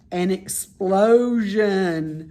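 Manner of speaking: slow, building to a loud burst; slow dramatic build to loud title. Acted. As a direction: announce it with suspense. Dramatic chapter title announce.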